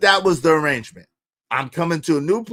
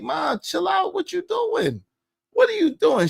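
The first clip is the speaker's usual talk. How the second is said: put-on teen son pleading; 11 semitones higher, 4 dB quieter than usual.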